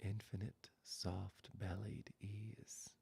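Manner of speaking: slow, soft, whispered meditation voice; hushed closing meditation, words spaced out; guided meditation. Acted. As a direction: ease them into rest, barely above a whisper.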